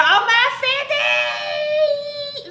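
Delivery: high drawn-out squeal; over-the-top celebratory shriek; excited celebration. Pretend. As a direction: squeal it, stretching the last word out long. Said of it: "Or should it be this way?".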